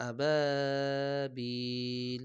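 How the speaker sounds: slow chanted আবাবিল; long, sung-out আবাবিল; drawn-out recitation. Shown as a demonstration. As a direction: stretch আবাবিল like tilawat. Quran reading drawn out.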